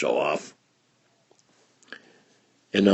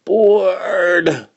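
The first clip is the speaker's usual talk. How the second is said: bored wail; 11 semitones higher, 7 dB louder than usual. Acted. Bored child crying out.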